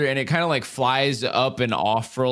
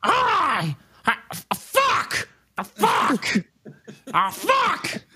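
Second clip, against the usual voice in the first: raspy cawing crow; much slower than usual. Acted. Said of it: "it goes".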